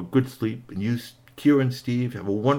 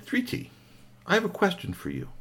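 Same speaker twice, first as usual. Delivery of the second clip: gentle higher storyteller voice; 9 semitones higher, 4 dB quieter than usual. Acted. Cuttlefish voiced to child.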